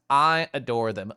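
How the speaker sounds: usual speaking voice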